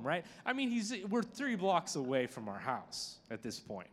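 ordinary talk, nothing marked

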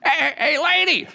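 very high parrot squawk; sing-song cheeky parrot. Acted. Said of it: "the parrot goes".

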